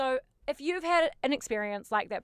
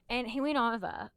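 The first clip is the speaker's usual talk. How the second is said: ordinary talk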